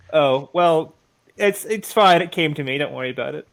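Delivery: ordinary talk, nothing marked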